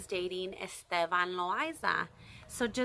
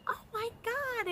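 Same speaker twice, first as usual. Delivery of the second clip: stunned. Retold or imitated. Fan hearing they must dance, very high and hushed. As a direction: breathe it out in shock. Reacting to singer's order.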